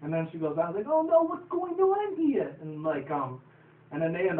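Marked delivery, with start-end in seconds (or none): 0.9-2.5 much higher, louder, slow cartoon panic; squeaky panicked pony. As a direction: shriek it out like a flustered cartoon pony. Pony panicking before crash.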